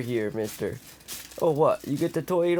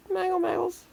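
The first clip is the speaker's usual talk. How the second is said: high cat call. Real, not acted. Calling the cat, high take.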